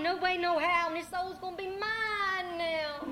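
storyteller's triumphant devil voice; gloating devil. Acted. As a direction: claim the soul with loud relish. Devil claims the man's soul.

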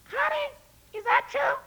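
high falsetto fretting queen; a fussy lady worrying aloud; squeaky high queen asking her husband; queen's voice. Acted. Queen asks the king.

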